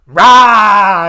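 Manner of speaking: loud, high drawn-out battle roar; hammed-up hero's scream. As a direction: belt out a mock war cry. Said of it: "just starts screaming at him".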